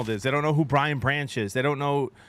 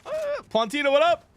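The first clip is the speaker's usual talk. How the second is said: bright greeting; 13 semitones higher, 4 dB louder than usual. Real, not acted. Greeting chatter by name.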